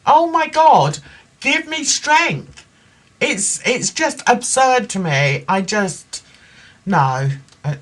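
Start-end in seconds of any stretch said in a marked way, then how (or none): 0.1-2.6 higher, louder plea of exasperation; exasperated. Real, not acted. Host appalled that she didn't stop, exasperated. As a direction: exclaim it, high and drawn out at the end.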